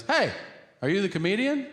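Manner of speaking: high-pitched imitated call; yelling out. Senator hailing the comedian from his house, high yell. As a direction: call out sharply to a passer-by. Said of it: "But he yells out".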